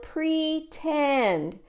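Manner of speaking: plain reading voice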